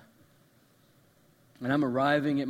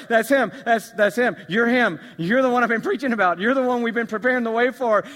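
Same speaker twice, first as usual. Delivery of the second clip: excited recognition; 6 semitones higher, 8 dB louder than usual. Retold or imitated. John recognizing Jesus approaching.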